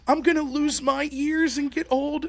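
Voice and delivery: high, slow imitation of a worried child; upset little son. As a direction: play a small boy dreading it. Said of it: "then he starts crying".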